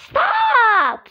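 high, loud shout to end the fight; shouted stop. Acted. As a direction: yell it out to break up the squabble. Toy fight, breaking it up.

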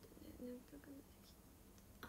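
soft drowsy whisper; sleepy whisper. Pretend. Pretending to fall asleep for viewers, in a drowsy whisper. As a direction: whisper like you're dozing off.

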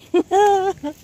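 a sudden high burst of laughter at the ruined garden; helpless laugh. Real, not acted. Gardener laughing helplessly at her dried-out plants, high laugh. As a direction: laugh out loud in disbelief. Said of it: "I don't know why I'm laughing".

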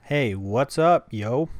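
loud, very slow casual greeting; casual dictation into phone. Shown as a demonstration. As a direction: say it slowly and loudly for the app.

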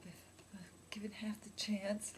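hushed whisper, almost confiding; whispered plea. Acted. Pleading to the pregnant friend in a whisper. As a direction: whisper it as if sharing a secret hope. Playing baby-obsessed woman.

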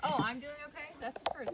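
projected teacher's voice; teaching voice. Real, not acted. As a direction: speak up as if teaching a class. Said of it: "I'm using my teaching voice".

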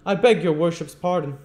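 high, loud disbelief; incredulous. A narrator's reading. Beadle doubting what the magistrate said, loudly incredulous. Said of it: "incredulous of having heard aright".

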